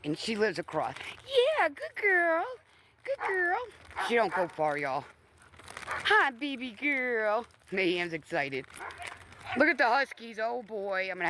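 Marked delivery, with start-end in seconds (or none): 1.6-3.6 much higher sing-song pet voice. Real, not acted. Owner coaxing a timid dog closer in high baby talk. 5.9-7.5 slow, drawn-out fond greeting. Real, not acted. Owner greeting a husky slowly in fond pet talk.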